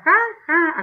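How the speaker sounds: high, loud spoken ha ha; mock laugh. Shown as a demonstration. Teacher reads the robbers' exclaimed laugh. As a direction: laugh it out as written. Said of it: "porque es una risa".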